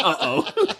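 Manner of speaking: high, comic uh-oh through laughter; mock uh-oh. Student realizing the professor is upset, high uh-oh. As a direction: play up the alarm. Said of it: "and I went".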